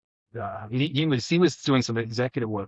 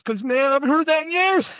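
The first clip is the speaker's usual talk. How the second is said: much higher, louder burst of joy; suddenly overjoyed to hear an old nickname; delighted; 14 semitones higher, 5 dB louder than usual. Retold or imitated.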